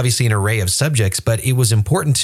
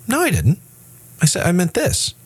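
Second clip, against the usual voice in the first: raised pitch, indignant protesting pastor; mock defensive denial; 9 semitones higher than usual. The evasive pastor denying his own words, mock defensive. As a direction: brush off the accusation as the pastor would.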